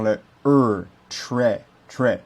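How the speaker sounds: très said with an English R, not French; slow English-R très; American R sound. Shown as a demonstration. Teacher shows how Americans say très with an English R. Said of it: "il n'y a pas le son R".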